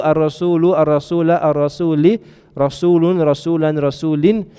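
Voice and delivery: "ar-rasulu, ar-rasula, ar-rasuli" then "rasulun, rasulan, rasulin", each final vowel spelled out clearly; Arabic case endings. Shown as a demonstration. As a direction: recite the endings -u, -a, -i and -un, -an, -in one by one. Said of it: "why do we change u".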